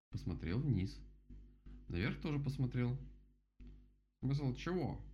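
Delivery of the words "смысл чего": wary question, pitch raised; cautious. A narrator's reading. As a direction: ask carefully, unsure what is meant. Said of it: "осторожно спросил он". (осторожный, настороженный вопрос)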